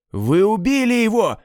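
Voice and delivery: отчаянный крик, намного выше и громче; закричи с горем и яростью. A narrator's reading. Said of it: "кричал Айзек". (Айзек кричит над телом брата, высоко и громко)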